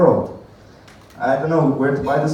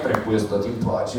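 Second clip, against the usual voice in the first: put-on Italian accent; 4 semitones lower, 5 dB quieter than usual. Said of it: "the only thing I know in Italian".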